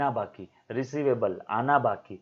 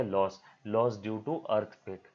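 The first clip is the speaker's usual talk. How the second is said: slow dictation; 3 semitones lower, 3 dB quieter, much slower than usual. Shown as a demonstration. Teacher dictates the term.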